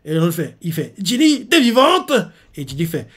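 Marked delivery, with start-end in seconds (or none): 1.0-2.1 high, slow joyful cry; overjoyed she is alive; relieved surprise. Retold or imitated. Brother greeting Ginny alive, high and slow with joy.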